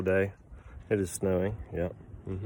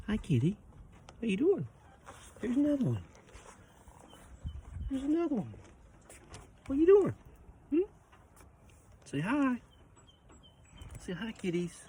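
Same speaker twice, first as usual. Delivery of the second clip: high pet voice; 13 semitones higher, 6 dB quieter than usual. Real, not acted. Greeting the farm cats, in a high cooing pet voice. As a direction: coo up high like talking to a kitten.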